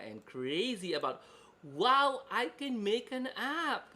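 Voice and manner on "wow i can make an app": high, loud, amazed exclamation; excited. Retold or imitated. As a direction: gush like a thrilled newbie. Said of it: "Kaganyan sasabihin nila".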